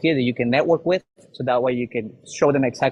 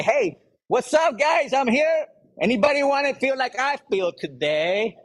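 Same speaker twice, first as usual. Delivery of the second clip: high-energy greeting; 10 semitones higher, slower than usual. Shown as a demonstration. Coach shows members how to greet a networking crowd, pumped up. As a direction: burst in like the most energized guy in the room. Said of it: "show up with energy and say".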